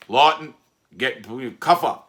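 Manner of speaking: loud, high barked order; guard calling out. Retold or imitated. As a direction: call the name across the tier. Guard calling his name.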